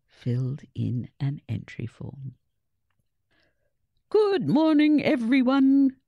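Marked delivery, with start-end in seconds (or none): 4.1-6.0 loud, high and cheery boom. A narrator's reading. Headmaster greets the school over the PA, booming. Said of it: "boomed the headmaster's cheery voice".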